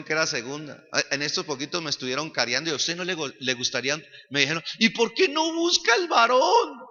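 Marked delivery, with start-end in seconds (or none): 4.8-6.8 high-pitched mock pestering question; needling, singsong-high imitation; imitated nagging. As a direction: mimic meddlers in a raised, high voice. Mimicking people pressing him about a son.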